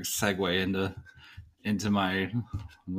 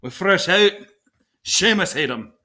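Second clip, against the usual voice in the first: put-on Irish accent; 9 semitones higher, 5 dB louder than usual. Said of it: "Irish accent".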